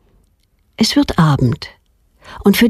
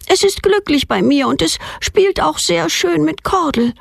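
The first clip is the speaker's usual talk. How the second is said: a hint of a defiant little girl, higher; lightly voiced girl; 10 semitones higher than usual. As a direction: plead her case like a stubborn child. Girl Petronella pleads with father. (leicht angedeutetes trotziges Mädchen, höher)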